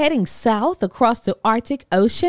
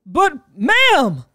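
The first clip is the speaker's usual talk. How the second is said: higher, louder blustering protest; blustering Santa; 7 semitones higher, 5 dB louder than usual. A narrator's reading. Santa protesting to the sat-nav, blustering. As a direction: sputter back. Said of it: "Santa blustered".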